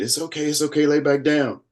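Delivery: high-pitched, insistent mock reassurance; acting out staff calming a patient, high and pressing; imitated urgent reassurance. Imitating medical staff urging him down.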